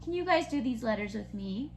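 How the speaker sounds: ordinary talk, nothing marked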